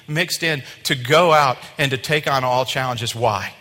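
loud, building, emphatic preaching; impassioned, punched sermon delivery. Real, not acted. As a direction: drive the promise home with conviction. Preaches with force.

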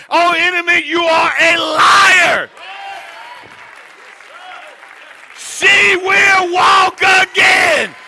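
loud, high, slow rebuke of the enemy; shouted rebuke. Retold or imitated. Woman rebuking the enemy, slow and shouted. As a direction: cry it out slowly, rebuking the enemy. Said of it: "she said it".

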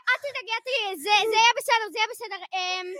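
ordinary talk

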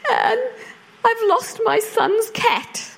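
high, drawn-out mock-tearful wail; imitated tearful neighbour. Neighbour rings about the lost cat, mock tearful. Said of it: "she's in tears".

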